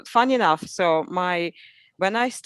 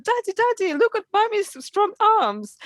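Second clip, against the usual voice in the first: put-on playful childlike call; 14 semitones higher than usual. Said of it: "I said".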